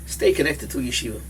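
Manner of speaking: ordinary talk, nothing marked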